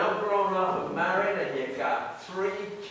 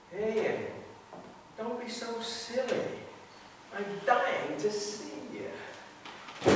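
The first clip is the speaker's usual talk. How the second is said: breathy phone whisper; 3 semitones higher, 6 dB quieter than usual. Acted. Flirting on the phone.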